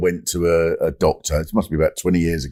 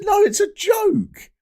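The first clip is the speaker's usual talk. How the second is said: high-pitched, hammed-up backtracking; retold anxious protest. Acted protest to the worried doctor.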